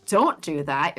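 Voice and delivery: ordinary delivery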